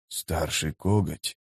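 slow, hushed, awed whisper; involuntary whisper. A narrator's reading. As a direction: let it slip out very softly. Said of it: "побледнеть и невольно прошептать". (невольный шёпот)

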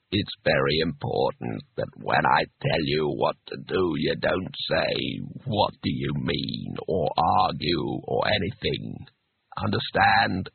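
low, slow, deliberate instruction; patient. A narrator's reading. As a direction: spell it out with strained patience, deep and slow. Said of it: "disembodied voice patiently".

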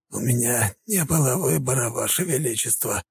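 strained, wheezing plea; hoarse wheeze. A narrator's reading. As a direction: rasp it out weakly. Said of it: "просипел князь".

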